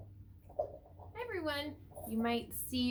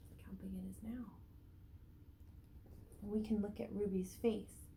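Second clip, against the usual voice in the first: usual speaking voice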